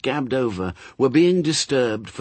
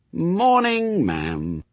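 lilting sing-song, much higher on Morning then falling on ma'am; 9 semitones higher, much slower than usual. Acted. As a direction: sing the greeting like a mock-polite tune. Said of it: "he said in a sing-song voice".

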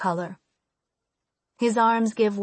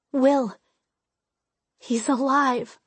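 higher, slow, relieved; relieved surprise; 4 semitones higher, slower than usual. Acted. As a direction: breathe out with relief. Relief seeing him alive.

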